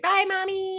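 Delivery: very high mock child voice; little girl goodbye. Voicing little girl's goodbye.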